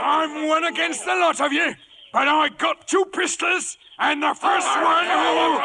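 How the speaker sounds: loud, high threat; defiant shout. Acted. Silver warning the pirate crew off, defiant shout. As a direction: hold them off with the pistols.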